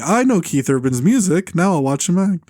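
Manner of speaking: a hint of a simple heartland moviegoer; Midwestern fan. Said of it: "the Midwestern folks saying".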